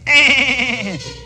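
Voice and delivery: high, pulsed mocking snicker; trumpet snicker. A narrator's reading. Trumpet mocking the tuba, high snicker. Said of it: "the trumpet snickered".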